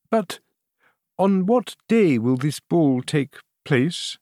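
weak, fading queen; faint dying voice. A narrator's reading. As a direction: barely get the words out. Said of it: "in an almost dying voice".

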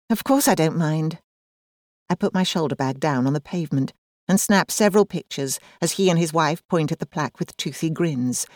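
ordinary delivery

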